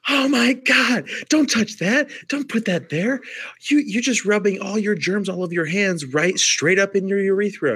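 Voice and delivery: high, alarmed scolding; horrified nurse. Retold or imitated. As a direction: shriek at the patient's dirty habits. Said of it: "I'm just like".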